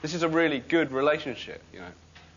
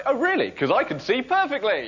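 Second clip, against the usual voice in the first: sarcastic retort; 10 semitones higher, 4 dB louder than usual. Retold or imitated. Mocking a blind person's claim.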